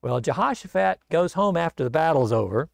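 usual speaking voice

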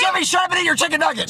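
exasperated order. Acted. Parent arguing with defiant child.

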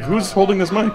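playfully startled, rising question; mock bewilderment. Acts puzzled at own hand.

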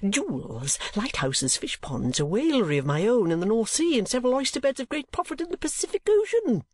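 rapid list climbing high; hurried, offhand boasting that rises in pitch; speaking very fast. A narrator's reading. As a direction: rattle off possessions airily. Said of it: "and speaking very fast".

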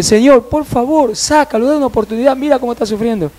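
high-pitched imploring; retold desperate intercession; earnest pleading. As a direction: beg on behalf of someone suffering. Acted plea on the child's behalf.

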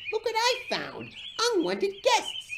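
high-pitched, scornful announcement; smug, high exclamation; smirking. A narrator's reading. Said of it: "smirked James".

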